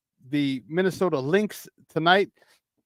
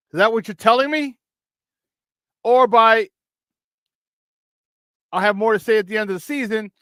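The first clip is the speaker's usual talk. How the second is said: higher, sarcastic rhetorical questions, building disbelief; incredulous sarcasm; 8 semitones higher, 4 dB louder than usual. Real, not acted. Host mocking the owner's promise of answers, incredulous. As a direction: needle the owner with mock questions.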